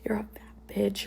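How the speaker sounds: slow, whispered quote of name-calling; whispered insult. Retold or imitated. Quoting boyfriend's name-calling to viewers, slow whisper. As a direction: whisper the insult slowly as you retell it.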